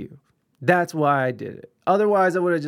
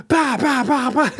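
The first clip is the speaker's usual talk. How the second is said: imitated punches; 9 semitones higher, 4 dB louder than usual. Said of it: "dude just like".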